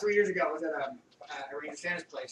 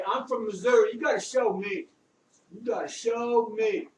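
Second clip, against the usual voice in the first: loud, high-pitched sergeant, words stretched out for emphasis; Staff Sergeant Donato Santaserra; 6 semitones higher, 8 dB louder, slower than usual. Retold or imitated. A Brooklyn sergeant harangues Gentile about his number, loud and taunting. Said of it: "he come up to you and be like".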